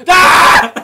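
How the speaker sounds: sudden very loud, high scream; jump-scare scream. Shown as a demonstration. Co-host demonstrates the film's jump scares, sudden scream. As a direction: blast out an abrupt "Ah!". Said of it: "It goes like".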